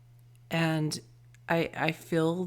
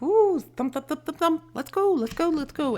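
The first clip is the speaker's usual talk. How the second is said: much higher, louder hopping bunny voice; eager bunny; 11 semitones higher, 7 dB louder than usual. Pretend. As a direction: bounce along like an excited rabbit, squeaky and urgent. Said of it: "A rabbit, like, on the go".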